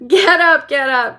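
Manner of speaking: loud, high cheery call; cried out. A narrator's reading. Wonka to the fallen passengers, loud cheery cry. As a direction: urge them up with glee. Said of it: "cried Mister Wonka, roaring with laughter".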